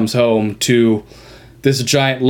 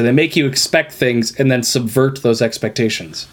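normal talking voice

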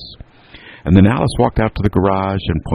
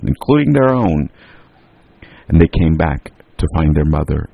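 usual speaking voice

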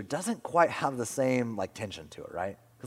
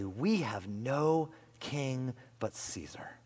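slow, hushed whisper; whispered declaration; 5 dB quieter, much slower than usual. Retold or imitated. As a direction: breathe it out slowly, like a terrible confession. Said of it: "But they say".

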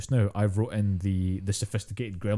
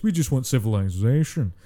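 louder, refined hobgoblin voice; cultured gremlin-style hobgoblin; sophisticated hobgoblin; 2 semitones higher, 5 dB louder than usual. Acted. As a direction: play a civilized monster speaking up grandly. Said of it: "started speaking like this".

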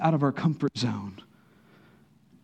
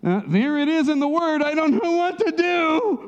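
high, slow, baffled voice; confused believer; 4 dB louder than usual. Retold or imitated. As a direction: play the lost, confused believer. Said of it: "You're like".

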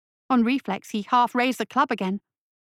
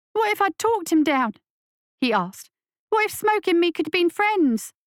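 put-on higher, urgent young voice; scared boy; 8 semitones higher than usual. A narrator's reading. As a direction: ask it like a frightened kid. Boy argues with monster.

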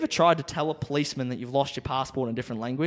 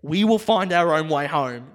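loud and slow, word by word; slow, emphatic instruction; 6 dB louder than usual. Retold or imitated. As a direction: spell it out for someone who barely follows. Spelling it out for driver.

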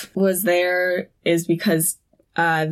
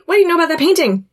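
high, rapid-fire questioning; super intense; 9 semitones higher, 3 dB louder, much faster than usual. Retold or imitated. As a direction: press the question urgently, fast and high. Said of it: "In, like, super intense way".

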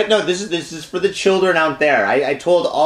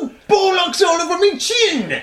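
very high, squealing English caricature; hammed-up falsetto Brit with a laugh; mock English accent; 13 semitones higher than usual. Host joking about bollocks, squealed mock English accent. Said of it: "Can you do an English accent?".

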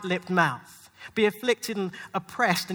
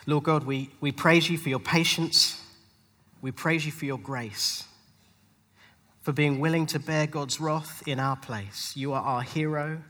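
lower, gentle prayer voice; 5 semitones lower than usual. Real, not acted. Pastor leading the congregation in prayer, gentle.

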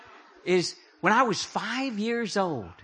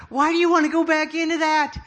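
higher mock reproach; Apostle Paul scolding; 7 semitones higher than usual. Paul warning believers, higher mock reproach. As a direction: voice Paul chiding them lightly. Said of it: "He said".

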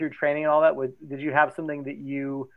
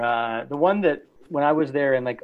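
ordinary talk, nothing marked

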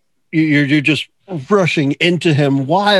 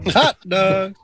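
stadium vendor; 4 semitones higher, much slower than usual. Acted.

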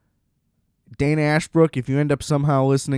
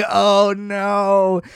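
loud, high "oh no" through a laugh; hammed-up dismay; mock mortified cry; 8 semitones higher, 5 dB louder than usual. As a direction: let the embarrassment burst out, high and loud. Mortified reaction to his reply.

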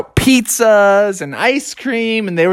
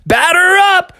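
put-on high kid calling the play; Froggy; 13 semitones higher, 4 dB louder than usual. Boy ready to bat an egg, calling out. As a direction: call it out like a ballplayer. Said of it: "and Froggy went".

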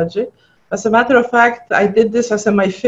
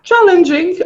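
emphatic; 9 semitones higher than usual. Real, not acted. Guest answering the host with one emphatic word. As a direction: land it with a knowing sigh.

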